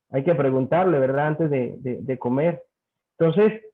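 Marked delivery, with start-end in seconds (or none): none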